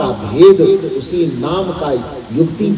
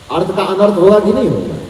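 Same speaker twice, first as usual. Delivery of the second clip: usual speaking voice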